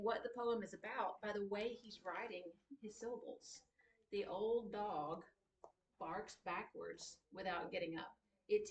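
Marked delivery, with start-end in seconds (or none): 4.1-8.1 slow, drawn-out reading of the line. Shown as a demonstration. Teacher showing students the old-dog line, read slowly. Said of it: "the line takes longer to say".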